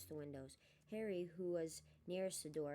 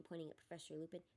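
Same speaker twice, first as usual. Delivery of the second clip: ordinary delivery, nothing marked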